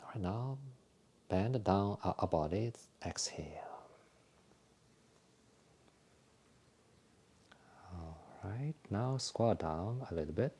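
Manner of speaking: soft, calm breathing cues with whispered exhales; guided exercise instructions. Acted. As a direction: talk the class gently through each movement. Leads a qigong breathing routine.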